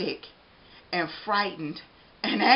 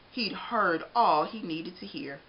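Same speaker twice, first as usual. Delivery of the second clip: plain reading voice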